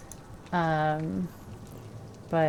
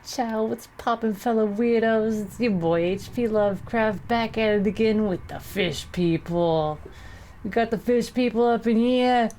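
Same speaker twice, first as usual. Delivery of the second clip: higher hyped vlogger greeting; YouTuber intro voice; 4 semitones higher than usual. Pretend. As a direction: greet your fans like a streamer. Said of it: "Let's give a thick Brooklyn accent".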